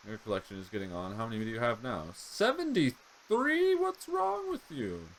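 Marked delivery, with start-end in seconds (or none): 2.1-5.1 very high, slow, shocked outrage; outraged disbelief. Real, not acted. Player reacting to the sister killing his rat, shocked squeal.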